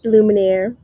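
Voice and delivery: usual speaking voice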